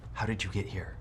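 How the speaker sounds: unvoiced in-character whisper. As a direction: whisper it so no one hears. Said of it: "I'm whispering".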